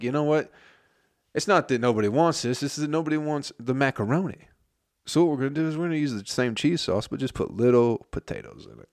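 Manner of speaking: mock pitch of a brilliant new plan; mock bright idea. Acts out owner pitching new dish idea.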